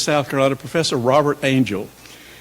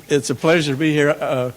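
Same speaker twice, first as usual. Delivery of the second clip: ordinary talk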